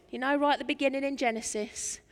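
ordinary delivery

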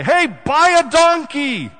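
high, loud, drawn-out shout; full-voiced holler, words stretched out; yell. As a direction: yell it slowly at a passing driver. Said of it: "I could yell at those people".

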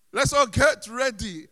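worship leader's big shout dying away; acted rallying call that trails into emptiness; calling out, fading. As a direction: start very loud and high, then peter out. Acts worship leader calling sparse congregation.

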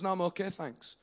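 soft, offhand refusal; quiet brush-off. Retold or imitated. Woman with ice pack declining prayer, soft and offhand. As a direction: politely wave him off under her breath.

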